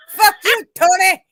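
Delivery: mock insult shout. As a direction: yell it like the running joke. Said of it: "that one where he says".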